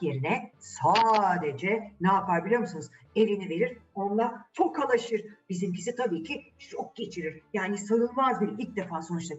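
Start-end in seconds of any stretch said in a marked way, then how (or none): none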